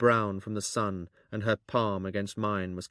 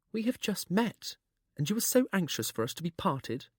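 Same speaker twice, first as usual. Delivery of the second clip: a lightly voiced young woman, higher; young girl voice; 10 semitones higher than usual. A narrator's reading.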